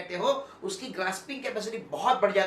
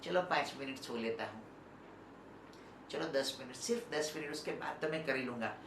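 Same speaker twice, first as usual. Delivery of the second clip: low, soft drowsy mumble of a lazy student; sleepy excuse; 7 semitones lower, 12 dB quieter than usual. Retold or imitated. Teacher imitating a sleepy student begging five more minutes, soft drowsy mumble. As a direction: mumble it like you're half asleep in bed. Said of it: "ऐसा मत बोलना".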